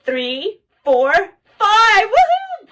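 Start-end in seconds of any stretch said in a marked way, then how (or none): 2.0-2.7 high, happy whoop; a high cheer over the birthday candles; excited woohoo. Real, not acted. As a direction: celebrate like a party guest. Celebrating candles found.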